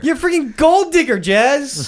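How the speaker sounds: high, loud teasing yell; playful high shout. Real, not acted. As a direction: squeal the insult, high and loud. Teasing echo.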